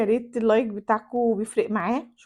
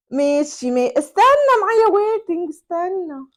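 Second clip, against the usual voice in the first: flirty hold; 7 semitones higher, 6 dB louder than usual. Acted. تؤجل المكالمة بصوت عالٍ.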